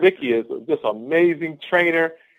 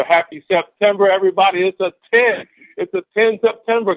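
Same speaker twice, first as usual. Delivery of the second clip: loud, raised, rallying cheer; excited cheer; 7 semitones higher, 7 dB louder than usual. Real, not acted. Speaker greets the team for the new month, excited cheer. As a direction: call it out to pump up the team.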